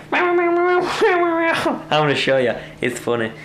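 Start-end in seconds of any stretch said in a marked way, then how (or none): 0.1-1.8 high, whiny run of meows; imitating whining cat. Owner shows the cat eating, imitating its high whine. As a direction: mimic a cat moaning into its food. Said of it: "the cow whines and moans".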